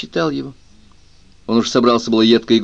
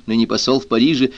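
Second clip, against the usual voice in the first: ordinary delivery, nothing marked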